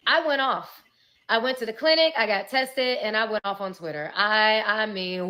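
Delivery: loud, high, heated retelling; worked-up storytime outburst; fired up. Real, not acted. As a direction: let it rip like you're reliving it.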